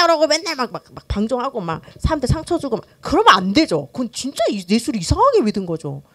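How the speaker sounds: ordinary delivery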